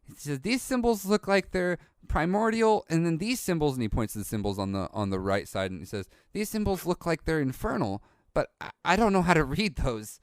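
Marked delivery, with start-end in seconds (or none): 0.4-3.8 put-on higher-pitched, puzzled young boy; curious young bookworm; young boy character. Game master plays young boy. 6.3-10.2 put-on higher-pitched, puzzled young boy; curious young bookworm; young boy character. Game master plays young boy.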